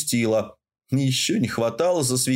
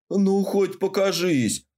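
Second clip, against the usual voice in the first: pleading; 7 semitones higher, slower than usual. A narrator's reading. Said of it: "попросил он жалобно".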